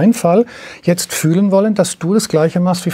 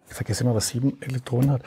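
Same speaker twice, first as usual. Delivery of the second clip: quiet aside; 4 semitones lower, 10 dB quieter, faster than usual. Real, not acted. Aside after chemistry metaphor.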